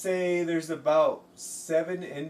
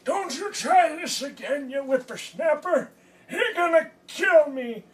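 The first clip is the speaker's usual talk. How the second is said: put-on high, loud outraged old-man voice; mock old codger shouting; cranky old computer; 10 dB louder than usual. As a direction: scold like a furious grandpa yelling at a whippersnapper. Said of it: "And was like".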